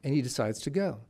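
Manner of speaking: normal talking voice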